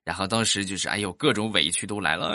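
normal talking voice

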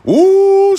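a sudden squealing high ooh, loud. Retold or imitated. Storyteller reacting to his ex's anger with a loud high ooh. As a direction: shriek ooh at the thought of her anger. Reacting to her getting pissed.

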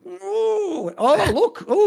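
mock surprised. Mock discovery.

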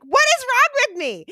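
high, loud squeal at herself; high pitched self-scolding; mock exasperation. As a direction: shriek it up in disbelief at yourself.

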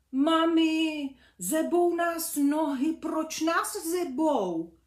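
high, loud complaining call; calling mum, cold. Acted. The cold little bees call to mum from bed, high and loud. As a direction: whine loudly about cold feet.

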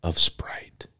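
breathy, hushed commercial whisper. Acted. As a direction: sell it in a sultry whisper.

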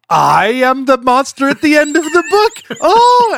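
high, excited puppet voice; Grover. Acted. Grover finding he is the monster, excited mock amazement. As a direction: play an astonished puppet, climbing to a loud high "oh". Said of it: "That's a good Grover voice".

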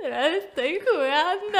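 slow, high, breaking voice; voz quebrada. Retold or imitated. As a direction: say it as if about to cry. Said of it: "así ya quebrada, llorando". (voz quebrada, lenta y llorosa)

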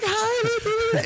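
squeaky high fake woman's hi; mock falsetto hi. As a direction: mimic a man faking a woman. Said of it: "do you really think women sound like that".

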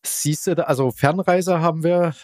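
usual speaking voice

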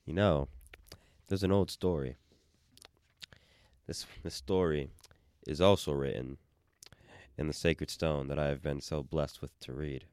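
low, calm storyteller voice; epic fantasy narrator. Pretend. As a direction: tell it like an ancient legend. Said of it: "my voice just went down ... super calm".

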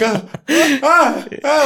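high, loud flustered stammer; panicking. Retold or imitated. Starstruck engineer losing his cool, high loud stammering. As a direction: stammer helplessly, overwhelmed. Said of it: "I don't know what to do".

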